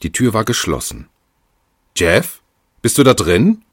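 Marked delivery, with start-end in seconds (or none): 2.0-3.6 raised, high call through a door; loud questioning call. A narrator's reading. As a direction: call to someone in the next room, rising at the end. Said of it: "rief sie".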